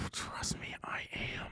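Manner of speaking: hushed, whispered aside; mock whisper. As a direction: whisper it under your breath. Joking aside after being teased.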